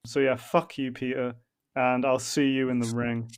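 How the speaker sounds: normal talking voice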